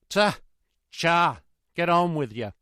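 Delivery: loud, high driving cries; sharp calls to get horses moving; coachman urging horses. A narrator's reading. Vexed coachman to his horses, loud urging cries.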